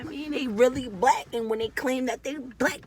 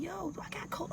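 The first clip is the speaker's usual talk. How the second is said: worried whisper; 8 semitones higher, 11 dB quieter, faster than usual. Retold or imitated. Whispering a worried memory. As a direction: whisper the thought.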